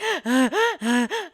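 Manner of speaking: sharp, loud gasp of hyperventilating crying; gasping sob imitation. Showing how she cried after the breakup, a loud gasping sob. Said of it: "where I'm like".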